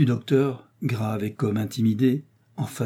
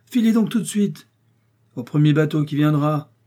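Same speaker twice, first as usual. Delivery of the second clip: louder, higher curt order to leave; angry dismissal; 5 semitones higher, 5 dB louder than usual. A narrator's reading. Master dismisses the frightened servant, angry louder order. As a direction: send him away in anger.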